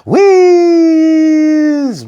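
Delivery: high, loud, drawn-out whiz; gleeful whiz. A narrator's reading. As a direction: call it out like flying downhill. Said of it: "went Katie".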